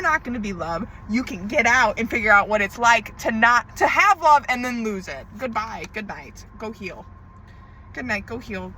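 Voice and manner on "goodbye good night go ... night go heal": curt dismissal. Real, not acted. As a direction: send them off quietly, done with it.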